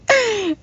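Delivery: high burst of real laughter; cracking up at the text; helpless high-pitched laugh. Real, not acted. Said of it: "I'm sorry to laugh".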